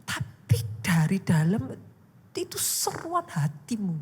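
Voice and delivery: strained half-whisper, slow at first; cry from within. Shown as a demonstration. Preacher showing a cry of the heart, intense and whispered. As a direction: press the words out from inside without volume. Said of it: "bukan masalah berapa keras suaranya".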